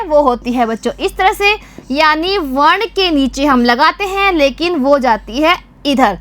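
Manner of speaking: ordinary delivery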